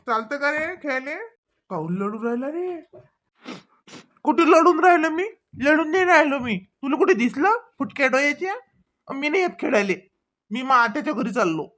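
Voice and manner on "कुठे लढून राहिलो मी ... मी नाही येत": indignant denial. Acted. Boy denies fighting angrily.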